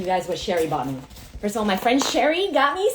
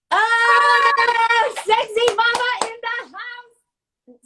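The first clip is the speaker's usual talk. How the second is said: whooping much higher greeting to the guest; excited welcome; 13 semitones higher, 6 dB louder, much slower than usual. Real, not acted. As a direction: announce her like a star arriving.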